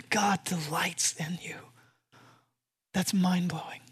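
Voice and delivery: slow, awed whisper; hushed whisper. Real, not acted. Preacher telling the church God delights in them, whispered. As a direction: let it drop to a breath of wonder.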